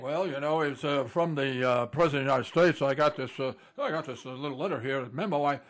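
low, mumbling, shrugging voice of a man following orders; mock soldier shrugging off murder; indifferent hired killer. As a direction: play a dull functionary who just did his job. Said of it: "And saying".

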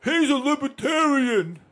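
slow, high, drawn-out mimicry; stretched-out mocking voice; mocked accuser. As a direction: imitate someone announcing it like a dopey tattletale. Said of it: "somebody had opened their mouth".

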